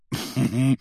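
short teasing chuckle. Acted. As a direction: chuckle knowingly in character. Hagrid chuckles while teasing Harry.

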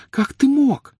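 high-pitched, dismayed wail; moaning reproach. A narrator's reading. Hermione reproaching Harry after the quarrel, moaning. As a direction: moan it as a pained accusation. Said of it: "простонала Гермиона".

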